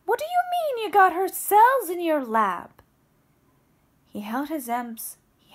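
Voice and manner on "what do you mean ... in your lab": loud, higher demanding shout; angry yell. Acted. Babette confronts the brother-in-law about the cells, loud angry yell. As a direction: shout the question right at him. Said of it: "Babette yelled".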